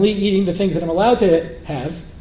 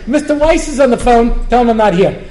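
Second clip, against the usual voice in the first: mock father; 4 semitones higher, 6 dB louder than usual. Kids voicing father's double message.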